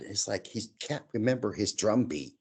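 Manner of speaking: ordinary talk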